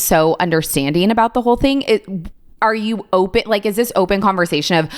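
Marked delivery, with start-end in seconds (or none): none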